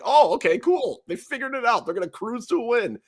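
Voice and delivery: high, bright fake optimism; sarcastic "oh cool, they figured it out"; mock cheerful sarcasm. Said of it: "And I was like".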